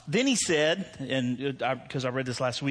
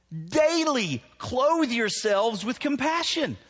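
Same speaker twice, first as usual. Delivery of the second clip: higher and slow, leaning on Daily; emphatic sermon line; 8 semitones higher, 4 dB louder, slower than usual. Real, not acted. Preacher urging the congregation to act daily, slow and emphatic. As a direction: press hard on Daily. Preacher driving point home.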